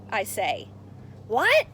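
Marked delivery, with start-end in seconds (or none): 1.3-1.7 loud, high shout; yell. Acted. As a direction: shout the question back from far off. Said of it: "he yells back".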